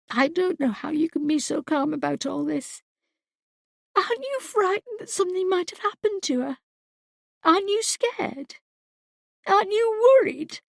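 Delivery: a frantic mother begging her husband to feel fear; panicked crying out. A narrator's reading. Mother pleads with calm husband, frantic and climbing. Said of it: "she cried".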